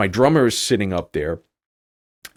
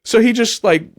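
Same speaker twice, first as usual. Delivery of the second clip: ordinary delivery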